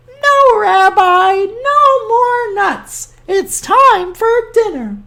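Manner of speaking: put-on high, sweet old woman; cheerful high granny voice; old grandmother voice. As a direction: play the kindly old granny talking to her guest. Baba Brena voice.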